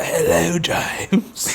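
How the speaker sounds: mock Scouse, falling to a whispery end; Liverpool accent. [name] greeting [name], hammed-up Liverpool accent. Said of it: "he had, like, a Liverpool accent or something".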